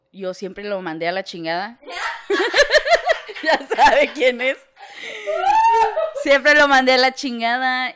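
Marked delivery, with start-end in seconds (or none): 1.8-3.4 high burst of real laughter. Real, not acted. Friend laughing at her own confession, high laugh. 3.4-7.9 laughing while talking. Real, not acted. Friend repeating her line to a friend, laughing.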